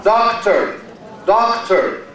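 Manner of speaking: "Doctor" stressed on the first syllable, DOC-tor. Shown as a demonstration. Teacher gives an example of first-syllable stress, "Doctor" said DOC-tor. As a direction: DOC strong in "Doctor", falling away. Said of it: "First syllable".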